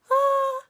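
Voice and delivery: high, loud confused yelp; loud, very high imitated shriek; mock startled cry. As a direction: do a stunned little squeal. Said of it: "we're like".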